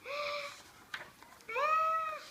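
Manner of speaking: gasping run-up to a sneeze; sneeze wind-up. Acted. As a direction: build the sneeze slowly upward. Sneeze building up.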